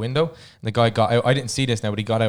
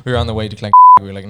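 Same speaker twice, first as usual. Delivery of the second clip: usual speaking voice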